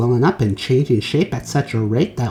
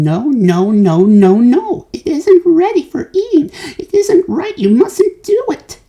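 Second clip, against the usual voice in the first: high, rising panicked protest; fussy high-pitched alarm; anxious pleading; 6 dB louder than usual. A narrator's reading. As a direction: beg them to stop, fretting. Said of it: "wringing his hands and saying".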